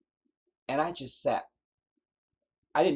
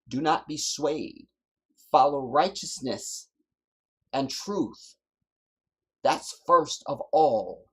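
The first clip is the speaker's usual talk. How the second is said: high, loud pulpit exhortation; fiery preaching; 4 semitones higher, 6 dB louder than usual. Real, not acted. Preacher urging his people to stand firm, in loud fiery preaching. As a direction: drive the charge home from the pulpit.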